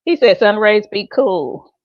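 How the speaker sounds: loud relay across the room; calling out. Real, not acted. Relaying messages to others off mic.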